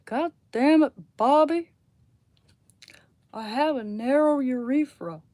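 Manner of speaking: loud Texan dad grumble; Hank Hill impression. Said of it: "I can actually do Hank".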